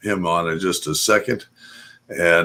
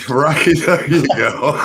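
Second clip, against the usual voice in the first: laughing; 6 semitones higher, 3 dB louder than usual. Real, not acted. Laughing at guest joke.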